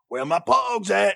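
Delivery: high, loud, drawn-out holler; big mock rapper yell; catchphrase question. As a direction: belt it out like a game's title slogan. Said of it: "you have to answer the question".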